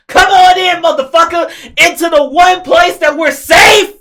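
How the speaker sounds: loud, high mock yell of her line; mocking shout. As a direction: shout it back at her, sneering. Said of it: "Your dumb ass wants to tell the fucking vampires".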